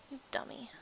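a soft unvoiced whisper to herself. Real, not acted. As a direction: mutter the insult under your breath, amused. Teasing herself after a blunder.

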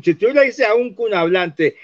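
usual speaking voice

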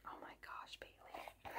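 breathless whisper of her name; awed whisper. Real, not acted. Vlogger whispering thanks to a friend, overwhelmed.

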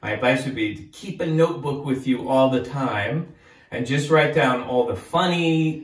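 ordinary delivery, nothing marked

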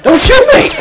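high, loud panicked yell; panicked shout. Real, not acted. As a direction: scream it as they aim at you. Pleading under fire.

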